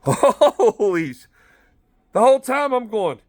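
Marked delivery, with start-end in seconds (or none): 0.0-1.3 laughing. Real, not acted.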